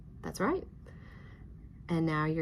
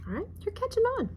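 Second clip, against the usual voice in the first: high praise. Real, not acted. Teacher praise.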